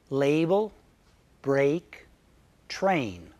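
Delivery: "Label", "break", "train" each spoken slowly alone with a long ay sound; vowel demo. Shown as a demonstration. Teacher modeling the ay vowel in "Label", "break", "train", careful and slow. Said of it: "Vowel number three is A".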